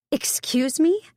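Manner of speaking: high, startled "Excuse me?"; shocked. A narrator's reading. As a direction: caught completely off guard. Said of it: "I almost dropped the phone".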